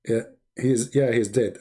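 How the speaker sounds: ordinary talk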